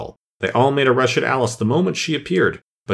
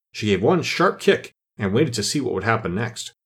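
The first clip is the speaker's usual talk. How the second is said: ordinary delivery, nothing marked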